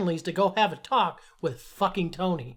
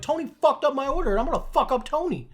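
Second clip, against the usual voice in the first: retold angry threat; 7 semitones higher, 3 dB louder, faster than usual. Retold threat at pizza counter.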